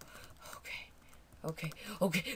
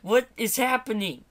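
ordinary delivery

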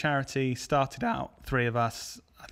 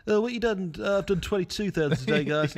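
a hint of a smug bragger; bragging show-off; 5 semitones higher than usual. Mimicking bragging group chat member.

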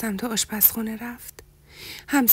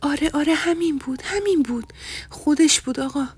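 higher, louder eager confirmation; light up and confirm it eagerly; 5 semitones higher, 4 dB louder than usual. A narrator's reading. Hasna eagerly confirms the name to Mahan, higher and louder.

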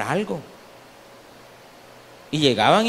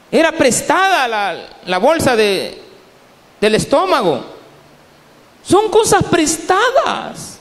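raised, emphatic sermon voice; forceful preaching; 5 semitones higher, 4 dB louder than usual. Real, not acted. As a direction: preach it out with high, ringing peaks.

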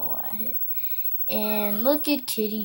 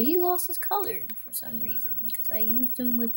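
whisper; 8 semitones higher, 5 dB quieter than usual. Real, not acted. Commenting on game quietly.

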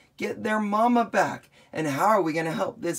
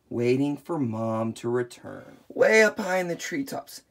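usual speaking voice

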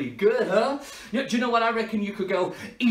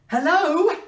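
higher, louder questioning hello; insistent phone hail; 10 semitones higher, 7 dB louder than usual. Pretend. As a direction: call harder down a bad line. Calling louder on bad line.

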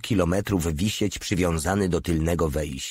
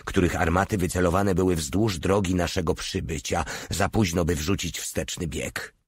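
plain reading voice

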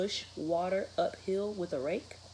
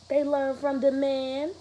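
proud scream; 11 semitones higher, 10 dB louder than usual. Acted. Dad boasting to the reporter from behind, a loud proud shout. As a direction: bellow it proudly across the crowd. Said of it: "Dad screamed from behind us".

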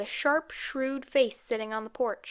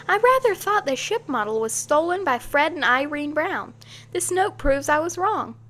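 animated, raised pitch and volume; excited declaration; 5 semitones higher, 5 dB louder than usual. A narrator's reading. Girl admitting her theory was wrong, excitedly. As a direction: explain with eager excitement. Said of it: "she declared excitedly".

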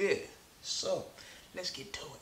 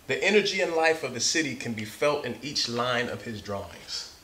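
story narration; 3 semitones higher, 7 dB louder than usual. Acted. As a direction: read it like to a class of kids. Reads picture book aloud.

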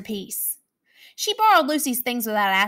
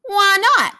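high loud monster; 10 semitones higher, 7 dB louder than usual. Acted. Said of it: "asked Flurry".

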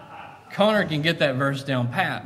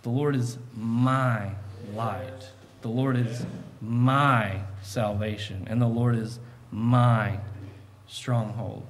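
slow and soft, stressing my; 2 semitones lower, 3 dB quieter, slower than usual. Shown as a demonstration. Preacher quoting David tenderly, stressing my. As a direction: linger on each my. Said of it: "He's saying".

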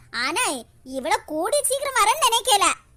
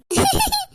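high giggle; 9 semitones higher than usual. Acted. Sister laughs at being told off.

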